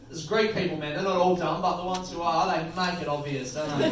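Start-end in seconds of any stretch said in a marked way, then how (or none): none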